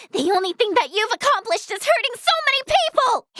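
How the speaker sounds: high, heated rebuke; indignant accusation. Acted. Healer rebukes someone for hurting people, indignant.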